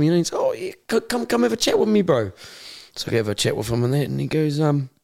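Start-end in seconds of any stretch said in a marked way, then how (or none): 0.4-2.3 high-pitched mock invitation; chaplain impression. Former inmate acting out the chaplain inviting him for a chat, high put-on voice. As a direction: imitate the chaplain coaxing him over, voice pitched well up.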